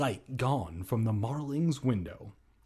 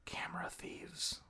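gasped spooky whisper; 15 dB quieter than usual. Acted. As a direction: hiss it in dread. Whispered horror aside.

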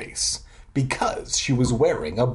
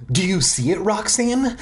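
excited, higher-pitched question; really excited; 7 semitones higher than usual. A narrator's reading. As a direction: ask it eagerly, voice lifted high. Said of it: "really excited".

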